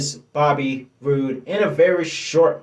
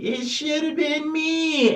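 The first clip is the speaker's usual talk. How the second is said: much higher, slow mock whimper; crybaby whine; 14 semitones higher, slower than usual. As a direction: whine it like a sulking kid. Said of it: "his crybaby".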